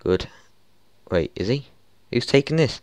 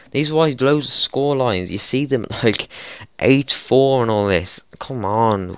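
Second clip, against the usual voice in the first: normal talking voice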